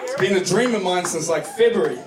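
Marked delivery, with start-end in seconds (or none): none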